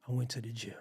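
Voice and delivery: whispered like a secret. Real, not acted. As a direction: lean in and whisper it, as if nobody should hear. Don't tell nobody.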